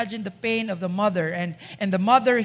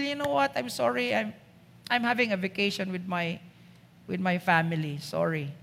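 brush-off; 5 semitones lower, 8 dB quieter, faster than usual. Acted. Doctor on vacation brushing off.

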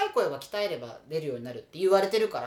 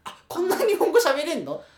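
laughing mock surprise; higher, laughing disbelief; surprised; 10 semitones higher, 9 dB louder, slower than usual. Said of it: "っていうので".

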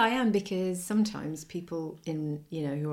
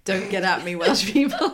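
laughing plea; 2 semitones higher, 8 dB louder than usual. Acted. Playful plea to Welsh listeners.

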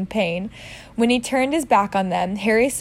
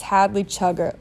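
usual speaking voice

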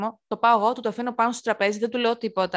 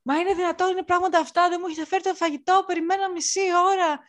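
higher, whiny complaining customer; lightly voiced impatient customer; mock indignant customer; 7 semitones higher than usual. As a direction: imitate a huffy diner who's been waiting. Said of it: "Και μου λέει".

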